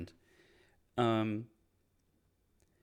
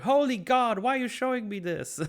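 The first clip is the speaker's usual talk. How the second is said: very high, louder squeal of disgust; Penguin voice; 6 dB louder than usual. Retold or imitated. Penguin shown the corpse photo, squealing high. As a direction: recoil from the photo in a shrill squeal.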